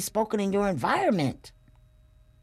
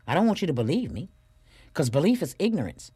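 ordinary talk, nothing marked

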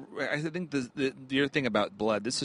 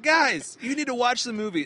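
exasperated; 9 semitones higher than usual. Pretend. Lecturing scientists in mock exasperation.

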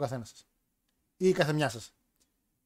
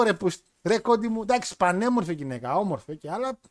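ordinary talk, nothing marked

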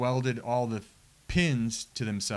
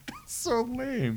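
laughing shout; 12 semitones higher, much slower than usual. Real, not acted.

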